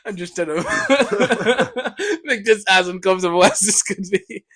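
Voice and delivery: laughing while talking; giggling through the line. Real, not acted. Laughing at own awkward question.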